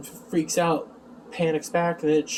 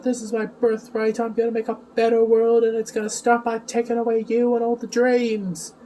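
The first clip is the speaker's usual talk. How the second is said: John; 6 semitones higher than usual. Retold or imitated. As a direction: play the aggrieved heir in a raised voice. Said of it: "he starts constantly talking about".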